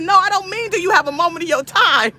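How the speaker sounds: high-pitched, emphatic retold objection; mock-exasperated retelling; insistent correction. Retelling her exasperated correction of clerk.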